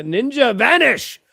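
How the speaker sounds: high, loud, drawn-out exclamation; mock ninja cry. Fleeing the TV room, hammed-up high shout. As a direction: call it out like a hammy escape move.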